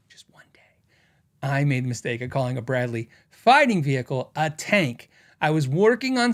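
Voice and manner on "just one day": hushed whisper to tease the story. Real, not acted. Storyteller whispering to viewers before the punchline. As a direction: drop to a secret whisper.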